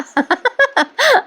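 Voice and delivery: a burst of high-pitched giggling; high giggle. Real, not acted. Host can't hold back a laugh, high giggle. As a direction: break into a high, real laugh. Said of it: "हसायला आलं मला जरा".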